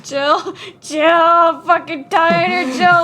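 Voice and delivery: loud, drawn-out yell at Jill; loud mock-exasperated groan at the game character. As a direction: yell her name in mock despair. Shouting at the character.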